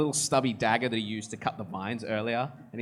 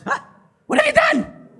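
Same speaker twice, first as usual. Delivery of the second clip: very high, loud shriek of a shocked dryad; a tree spirit horrified at the sight; alarmed dryad; 11 dB louder than usual. Acted.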